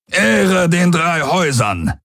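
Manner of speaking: high, drawn-out battle slogan; war cry. A narrator's reading. Warlord leads his troops into the fight, calling a war cry. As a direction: cry it out as you throw yourself into battle. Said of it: "rief Daraios und warf sich in die Schlacht".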